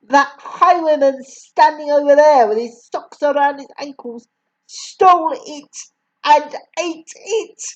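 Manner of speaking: raging headmistress. A narrator's reading. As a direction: rant like a furious tyrant. Headmistress rants at the boy.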